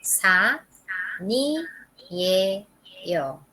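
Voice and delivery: "Sa, ni, ye, yo" separated by pauses, N starting "ni"; syllable by syllable. Shown as a demonstration. Teacher reading it out for students, syllable by syllable. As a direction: pause after each of Sa, ni, ye, yo. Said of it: "va a leer aquí".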